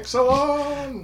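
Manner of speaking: high, slow, drawn-out hype call; excited announcer. Acted. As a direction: announce the name like a game-show host. Hyping the set name.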